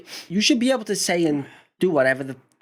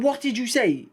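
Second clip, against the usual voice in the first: stern dad; 9 semitones higher than usual. Retold or imitated. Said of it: "I said".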